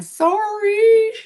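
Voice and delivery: sudden high, small sorry; squeaky apology. Retold or imitated. Patient apologising mid-meltdown to the staff, high and squeaky. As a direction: flip up into a tiny apologetic voice. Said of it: "I was screaming at people, but yet going".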